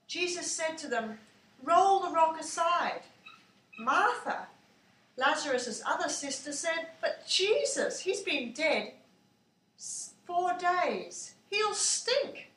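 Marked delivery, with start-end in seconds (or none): 1.6-3.0 louder, commanding; firm command. A narrator's reading. Jesus ordering the crowd at the tomb, loud command. 7.0-12.5 higher, hesitant objecting voice; worried protest. A narrator's reading. Martha warning Jesus about the tomb, higher worried protest.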